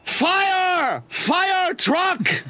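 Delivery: high, panicked mock Mexican accent; stammer, then yelp in broken Mexican-accented English; imitated Mexican co-worker's frantic accented shout. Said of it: "he spoke very broken English".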